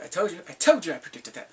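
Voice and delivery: normal talking voice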